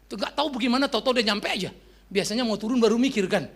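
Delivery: usual speaking voice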